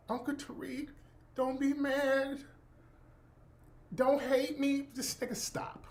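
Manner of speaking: high, slow, soft whiny plea; mock sad nephew. Nephew begging his uncle, mock whiny plea. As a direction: ham up a guilty kid begging.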